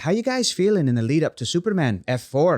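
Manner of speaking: half-committed Northern Irish accent; bad Irish accent, breaking into a laugh at the end; terrible Irish accent. Shown as a demonstration. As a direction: read it out in a shaky-footed Irish accent. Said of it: "Sorry, that's terrible".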